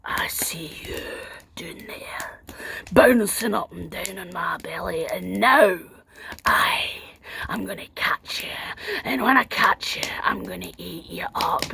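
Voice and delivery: low unvoiced whisper of a waking giant; giant's menacing whisper. Giant waking to find the girl on his belly, menacing whisper. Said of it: "he said".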